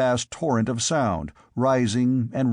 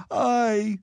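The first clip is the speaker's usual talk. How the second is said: sobbing; 10 semitones higher than usual. Acted. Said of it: "sobbed the squire".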